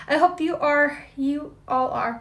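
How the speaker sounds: ordinary delivery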